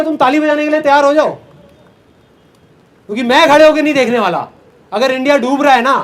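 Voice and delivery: loud, high, defiant rallying cry; impassioned declaration. Retold or imitated. Speaker challenging his cynical friends, impassioned declaration. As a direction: throw down the challenge at full voice.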